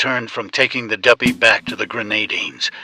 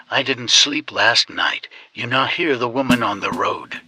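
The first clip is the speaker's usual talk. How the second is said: usual speaking voice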